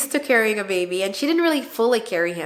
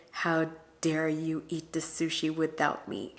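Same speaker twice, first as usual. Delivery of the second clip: soft mock scolding, much quieter; mom's quoted scolding; 3 semitones lower, 7 dB quieter than usual. Retelling the stroke-recovering mom scolding her daughter, mock scold, soft. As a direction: quietly imitate a frail mother's complaint. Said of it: "his mom was basically saying".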